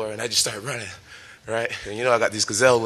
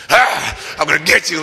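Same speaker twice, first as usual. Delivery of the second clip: loud high bark at the guy; a yelled mock threat, pitched way up; 5 semitones higher, 7 dB louder than usual. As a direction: bark it out to scare him. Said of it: "started barking".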